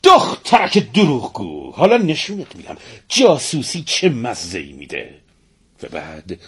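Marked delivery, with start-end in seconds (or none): 0.0-5.1 enraged mistress, pitched up; angrier. A narrator's reading. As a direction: threaten the servant in rising fury. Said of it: "بیشتر عصبانی شد و گفت".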